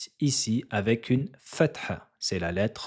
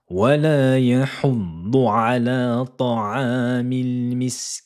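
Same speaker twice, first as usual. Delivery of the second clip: Quran recitation; 3 semitones higher, 5 dB louder than usual. Shown as a demonstration. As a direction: recite the verse in melodic chant.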